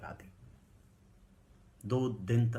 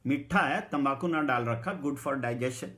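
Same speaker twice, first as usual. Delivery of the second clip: loud, hearty offer; 3 semitones higher, 7 dB louder than usual. Retold or imitated. Man offering paan to the narrator, loud and hearty. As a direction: press the paan on him warmly.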